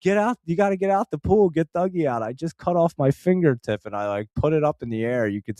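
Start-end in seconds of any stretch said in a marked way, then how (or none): 0.0-3.8 retold yelling. Man telling his wife to leave the pool, mock urgent yell. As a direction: re-enact an urgent yell at ordinary volume. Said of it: "I'm yelling to my wife".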